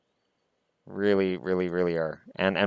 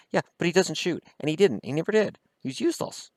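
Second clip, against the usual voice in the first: higher exasperated rant; 9 semitones higher than usual. Real, not acted. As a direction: vent about a useless player. Ranting about useless player.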